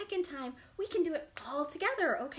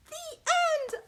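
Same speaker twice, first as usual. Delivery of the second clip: cheer; 14 semitones higher, 7 dB louder than usual. Acted. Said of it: "story finale".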